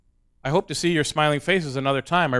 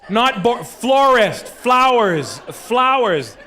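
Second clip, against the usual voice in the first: louder, higher, drawn-out correcting; exasperated correction; 6 semitones higher, 4 dB louder, much slower than usual. Retold or imitated. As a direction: correct a phone that keeps mishearing. Correcting phone that misheard him.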